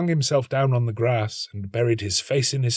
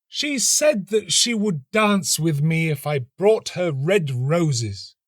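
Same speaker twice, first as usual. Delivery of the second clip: put-on high, slow, pleading young student; 8 semitones higher, 4 dB louder, slower than usual. A narrator's reading. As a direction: ache for the roses, lifted high and slow. Student's lament in voiced role.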